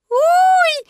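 very high piglet yelp; squealing. A narrator's reading. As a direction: yelp while being scrubbed hard. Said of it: "пищал Пятачок". (пронзительный писк)

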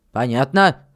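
low, firm check with a rise at the end; firm questioning "Понятно?"; stern miller's question. Acted. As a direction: make sure the boy has understood. Miller checks the boy understood.

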